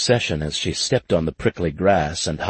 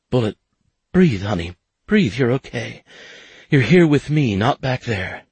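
higher, louder, anxious soothing; woman pleading gently but urgently; urgent comfort; 6 semitones higher, 3 dB louder than usual. Acted. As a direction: reach him through his panic. She calms his panic.

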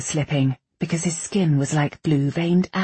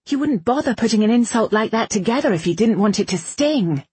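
high, heated retort; raised pitch, pressing and aggrieved; indignant Irene; 7 semitones higher than usual. A narrator's reading. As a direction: throw the rival's insults back at them. Irene argues back at Bradamant.

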